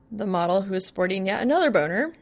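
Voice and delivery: ordinary delivery, nothing marked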